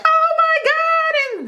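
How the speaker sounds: very high, slow, drawn-out gushing; mimicking gushing relatives. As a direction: play the relatives swooning over the bride. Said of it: "everybody's like".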